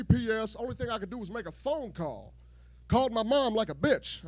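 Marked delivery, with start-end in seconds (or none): none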